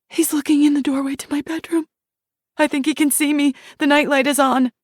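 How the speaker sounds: put-on whisper-fast fear of a child hiding; terrified child. Shiloh as a child hiding from the monster, terrified high voice.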